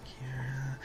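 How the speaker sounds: whispered note to self; whispering. Real, not acted. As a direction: whisper it while writing it down. Muttering a note to himself.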